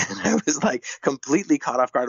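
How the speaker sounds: ordinary talk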